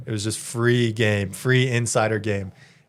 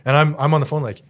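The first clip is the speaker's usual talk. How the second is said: ordinary delivery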